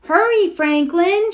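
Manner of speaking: a loud call to a friend; shouted. A narrator's reading. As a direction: call out across the play. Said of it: "shouted Bear".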